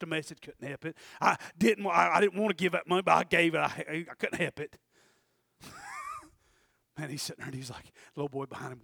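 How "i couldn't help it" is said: usual speaking voice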